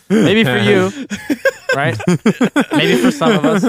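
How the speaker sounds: laughing tease. Real, not acted. Teasing friend, laughing.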